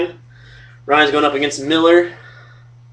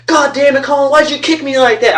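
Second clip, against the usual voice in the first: high, strained mock yell of a friend in pain; retold pained yelling; 10 semitones higher than usual. Friend yelling at narrator after a knee injury, high mock yell. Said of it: "He was yelling right at me".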